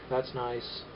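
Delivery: dry, soft sarcasm; sarcastic. Shown as a demonstration. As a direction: say it flatly, not meaning it. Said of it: "Yeah, I'm being sarcastic".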